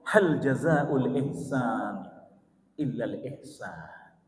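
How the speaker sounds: very slow, drawn-out Quranic chant; slow chanted scripture, trailing off; Quran recitation. Acted. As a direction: intone each word slowly, fading at the end.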